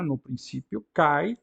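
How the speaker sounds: usual speaking voice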